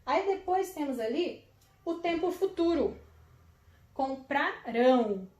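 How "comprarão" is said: Comprarão said slowly, leaning on the final rão; stressed last syllable. Shown as a demonstration. Teacher showing pupils where the accent falls, slow and stressed. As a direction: spell out each syllable, landing hard on rão. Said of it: "O acento está na última sílaba".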